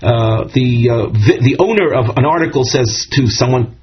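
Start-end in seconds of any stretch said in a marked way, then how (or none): none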